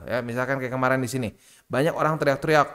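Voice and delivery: ordinary delivery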